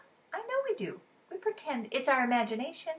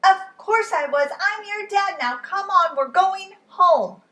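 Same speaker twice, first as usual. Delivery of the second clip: reassuring dad; 10 semitones higher, 11 dB louder than usual. Acted. Father reassures son.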